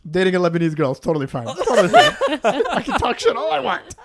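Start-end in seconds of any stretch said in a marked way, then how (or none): 1.4-3.9 laughing disclaimer. Real, not acted. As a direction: joke defensively through laughter. Joking disclaimer while cracking up.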